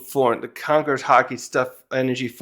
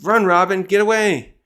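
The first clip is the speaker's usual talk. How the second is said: higher, louder mock yell to flee; warning shout; 6 semitones higher, 4 dB louder than usual. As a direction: urge Robin to run, voice raised. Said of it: "the other's like".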